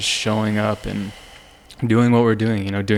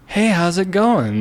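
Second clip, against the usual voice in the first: higher, slow, cheerful imitated hello; mock friendly greeting; 9 semitones higher, slower than usual. The neighbor now greeting him warmly, slow higher mock hello. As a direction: greet him like an old friend, lightly acted.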